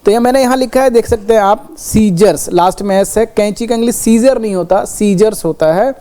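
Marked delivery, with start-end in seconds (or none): none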